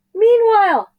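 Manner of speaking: high, loud yell; desperate shout. Acted. Boy falling from space yells the magic word, high and loud. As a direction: shout it to escape.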